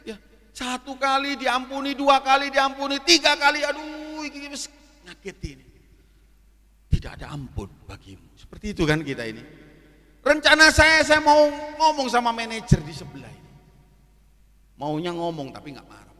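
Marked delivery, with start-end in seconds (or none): 0.1-5.7 tinggi dan keras, makin memuncak; seruan kesal. Retold or imitated. Menghitung kejatuhan yang mengagetkannya. 10.2-13.5 seruan tinggi dan keras; protes menggebu. Retold or imitated. Menceritakan niat protes dengan menggebu.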